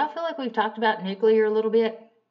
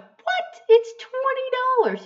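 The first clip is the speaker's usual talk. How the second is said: much higher, shrieking disbelief at the price; shocked outburst; 7 dB louder, slower than usual. Retold or imitated. Customer hearing the oil change price, high shocked yelp. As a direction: yelp it like the bill just hit you. Shocked at the eighty-four dollar bill.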